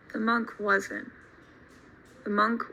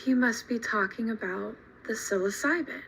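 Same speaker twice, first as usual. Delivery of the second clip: quiet realization; 6 dB quieter than usual. Retold or imitated. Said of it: "Richard Alpert is like".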